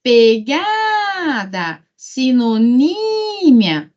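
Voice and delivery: PeGAAAda, sinoNÍÍÍmia, stressed syllable drawn right out; calling the word. Shown as a demonstration. Teacher showing students how to find the tonic syllable, calling the word. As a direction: call out each word, stretching GA and NÍ. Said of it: "Então, chama lá".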